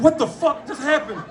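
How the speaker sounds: what the hell just happened, laughing; stunned disbelief. Retold or imitated. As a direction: blurt it out in disbelief. Shocked reaction on phone.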